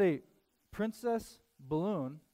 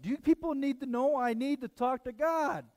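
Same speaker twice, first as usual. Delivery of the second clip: much higher and louder, exasperated cry at the crowd; frustrated outburst; 10 semitones higher, 6 dB louder than usual. Retold or imitated. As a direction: throw up your hands at the full coffee shop. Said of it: "getting frustrated".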